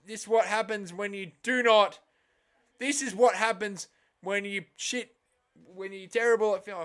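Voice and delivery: loud, high commentator call of the moment; mock commentary. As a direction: call it like a TV commentator, fumbling the words. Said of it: "I fucked up the commentary".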